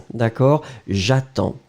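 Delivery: usual speaking voice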